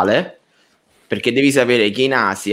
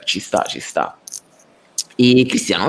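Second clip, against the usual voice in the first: ordinary talk, nothing marked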